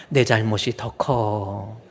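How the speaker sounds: "내 잘못이 더 커" said slow and loud, each word weighted; slow emphatic confession. Shown as a demonstration. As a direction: drag out "내 잘못이 더 커" firmly for the congregation to repeat. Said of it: "따라합시다". ("내 잘못이 더 커"를 천천히 힘주어)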